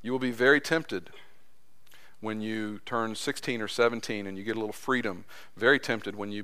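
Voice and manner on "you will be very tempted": normal talking voice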